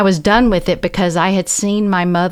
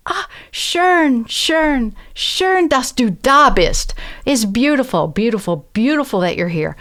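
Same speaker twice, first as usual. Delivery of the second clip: mildly high-pitched, imitated cooing welcome; delighted welcome; 5 semitones higher than usual. Retold widow's delighted welcome in German.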